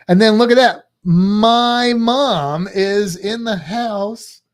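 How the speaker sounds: loud, drawn-out hype call; loud announcement. Real, not acted. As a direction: announce a guest loudly, stretching the words. Announcing mom has joined the stream.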